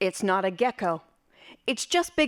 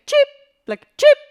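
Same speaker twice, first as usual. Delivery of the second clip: very high, loud electronic chirp; imitated alarm cheep; 12 dB louder than usual. Shown as a demonstration. Storyteller imitating the gas detector, piercing cheep. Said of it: "more like a cheeping".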